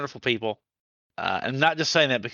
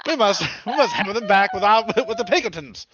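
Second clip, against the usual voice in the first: put-on higher, old-movie Mid-Atlantic accent; 9 semitones higher, 5 dB louder than usual. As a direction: play a haughty Mid-Atlantic robber baron. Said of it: "did I have the Mid-Atlantic accent?".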